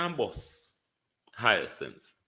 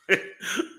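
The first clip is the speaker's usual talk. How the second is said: high laugh; 10 semitones higher than usual. Real, not acted. Laughing at own provocation.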